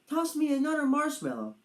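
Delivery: lightly voiced eager dragon. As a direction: ask for a treat like a keen kid. Padraig asks eagerly.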